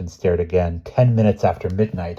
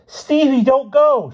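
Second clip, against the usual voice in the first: loud, very high desperate plea; high-pitched screamed appeal; 8 dB louder, slower than usual. A narrator's reading. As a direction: scream for him to stay. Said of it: "she screamed again".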